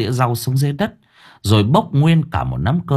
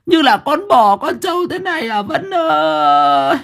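put-on indignant old grandmother; 13 semitones higher than usual. A narrator's reading. Grandmother demanding meat from her daughter, high wailing scold. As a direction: scold and lament, rising into a shriek.

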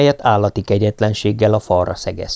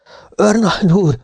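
high, urgent shout to the major; calling out; 10 semitones higher than usual. A narrator's reading. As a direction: cry out for the major in alarm. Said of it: "kiáltotta". (magas, sürgető kiáltás)

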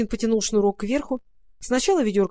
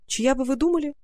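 usual speaking voice

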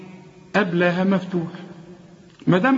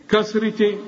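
normal talking voice

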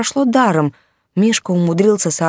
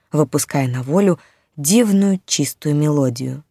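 ordinary delivery, nothing marked

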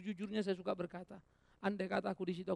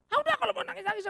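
much higher, louder exasperated snap; annoyed son; 12 dB louder than usual. Retold or imitated. The young preacher scolding his crying mother, exasperated and loud. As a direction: brush her off impatiently. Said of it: "saya paling jengkel kalau dia pulang nangis".

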